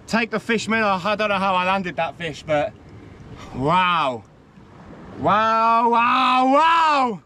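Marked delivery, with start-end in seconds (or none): none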